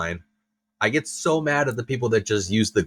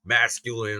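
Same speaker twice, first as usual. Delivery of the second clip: ordinary talk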